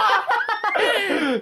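high squealing laugh; burst of laughter. Real, not acted. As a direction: break into laughter. Laughing at own joke. (大笑)

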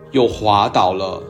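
plain reading voice

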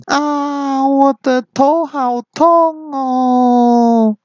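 high, slow wailing monster voice; crying out in pain. Acted. The big monster flat on the ground, high slow whining of pain. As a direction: drag it out whining about the pain. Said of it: "双手捧着头大喊".

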